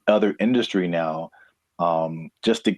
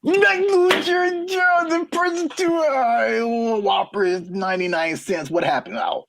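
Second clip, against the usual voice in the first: squeaky, much higher mocking voice. Co-host mocks job losses in a squeaky high voice. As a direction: pitch way up and tease them.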